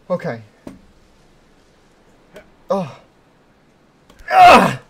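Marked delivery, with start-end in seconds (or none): 4.3-4.8 sharp high yelp; startled yell. Real, not acted. Streamer yelping at a sudden drop. Falling in the game.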